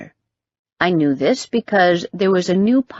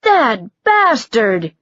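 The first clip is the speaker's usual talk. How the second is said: mock outrage; 5 semitones higher, 3 dB louder, much slower than usual. A narrator's reading.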